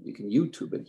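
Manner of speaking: ordinary talk, nothing marked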